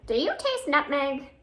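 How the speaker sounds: usual speaking voice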